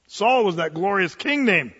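usual speaking voice

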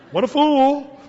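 self-mocking passenger. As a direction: call yourself a fool in disgust. Passenger feels like a dupe.

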